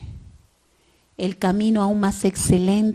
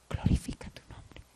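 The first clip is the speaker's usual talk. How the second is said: whisper; 12 dB quieter than usual. Acted. Quoting Jesus' prayer.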